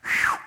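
sudden loud whoosh; one sharp burst of air; loud whoosh that stops dead; imitated wind cut-off. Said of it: "Just like that".

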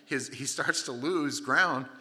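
ordinary delivery

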